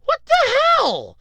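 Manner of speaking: very high, drawn-out loud exclamation; hammed-up retold bafflement; exasperated disbelief. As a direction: squeal it slowly in mock outrage. Retold baffled reaction to mom.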